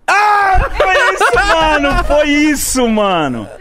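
high, loud comic wail; hammed-up shriek of disbelief; outraged outburst. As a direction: cry out in mock horror, easing at the end. Acting out the shocking moment.

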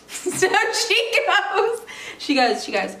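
high, drawn-out words through laughter; laughing. Real, not acted. Storyteller resuming after brain trauma joke, laughing. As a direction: struggle to get it out while laughing.

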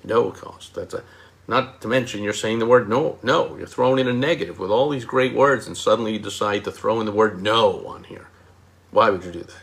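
ordinary talk, nothing marked

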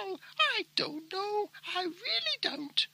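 high, soft pet voice mimicking its owner; a talking dog attempting the host's catchphrases; Simba imitating Jeffrey. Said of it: "gonna do an impersonation of Geoffrey".

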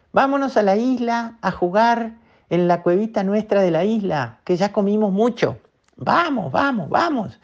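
a tiny otter tugging at her siblings; littlest otter. Acted. Littlest otter begging the others to go play, high and eager. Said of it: "la nutria más chiquitita dijo".

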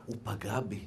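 low, soft mock-wounded reply; hurt wife. Wife explaining her grievance to rabbi, softly wounded. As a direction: drop it low and small, as if hurt.

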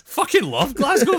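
high, loud, drawn-out exclamation; hammed-up gleeful cry of civic love; retold delight. Retells own delighted reaction to swearing.

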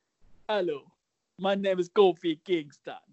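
slow, drawn-out imitation of a Jamaican accent. Host imitating a wrestler's debut intro, in a Jamaican accent. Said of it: "มาเป็นสำเนียงจาไมกาเลย".

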